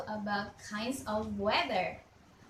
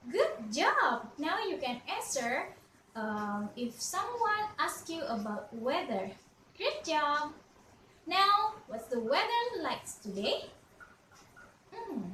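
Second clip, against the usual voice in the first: ordinary talk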